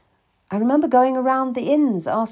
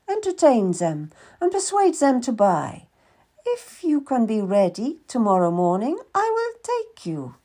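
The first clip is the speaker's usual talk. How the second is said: a much higher, brisk merchant woman's voice; Madame Marchand; 4 semitones higher than usual. Acted. Madame questions the boy seeking work, higher businesslike voice. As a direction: play a shrewd, businesslike older lady.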